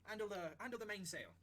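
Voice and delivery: crew member calling orders. Acted. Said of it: "one of the crew members goes".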